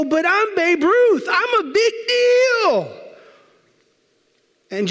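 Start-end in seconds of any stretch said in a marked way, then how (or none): 0.0-3.0 high, whiny protest, voice near cracking; indignant celebrity. Retold or imitated. Babe Ruth protesting to Jesus at the gate, high and whiny. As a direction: plead your fame like a hurt star.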